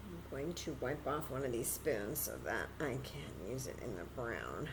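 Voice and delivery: usual speaking voice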